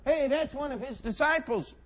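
high, loud finger-pointing; accusing callout. Acted. Bystander points out Peter as a disciple, loudly accusing.

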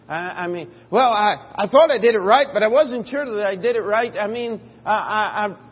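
loud, high, fretful second-guessing; anxious self-doubt. Acted. Preacher acting out a worried second-guesser, loud and anxious. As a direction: fret out loud like someone unsure of himself.

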